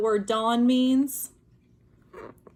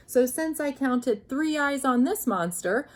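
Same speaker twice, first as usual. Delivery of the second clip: usual speaking voice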